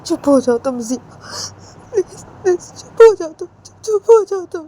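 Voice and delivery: high, loud sobbing pleas; crying out loud, voice breaking. Acted. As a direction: beg her to stop through tears. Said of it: "रोते रोते जिया को बोलती है".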